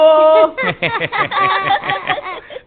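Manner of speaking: loud, high-pitched laugh bursting out; high burst of laughter. Acted. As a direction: let out a big high cackle of delight. (yüksek sesli, tiz bir kahkaha)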